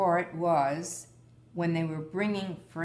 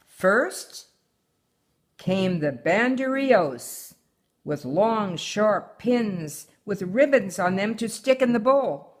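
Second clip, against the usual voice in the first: put-on reading a picture book aloud to her child; story reading; 5 semitones higher, 9 dB louder than usual. A narrator's reading. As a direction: tell the tale gently, like a bedtime story. Mother reads story aloud.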